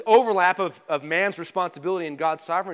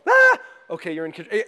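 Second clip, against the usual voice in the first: mock relief; 6 dB louder, faster than usual. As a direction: snap out of the panic with a yelp.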